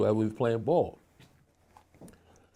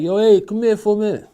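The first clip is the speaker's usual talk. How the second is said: higher, louder call across the street; 11 semitones higher, 9 dB louder than usual. Retold or imitated. Alpo calling him from the car, loud call. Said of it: "was like".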